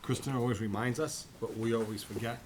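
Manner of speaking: normal talking voice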